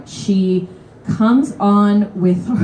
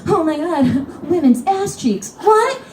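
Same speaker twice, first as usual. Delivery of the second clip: high squeaky shocked outburst, rising to a peak on what; pearl-clutching disbelief; mock shocked; 10 semitones higher, slower than usual. Seat hog reacting to the woman's request, mock shock.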